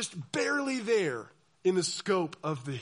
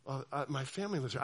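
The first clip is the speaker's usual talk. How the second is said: meek nervous plea; 5 semitones lower, 7 dB quieter, faster than usual. Retold or imitated. Meek dissenter pleading.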